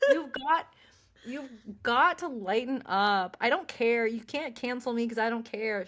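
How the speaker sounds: defiant. Real, not acted. Host to cohost, loud, defiant and giddy. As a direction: laugh off the worry, loud.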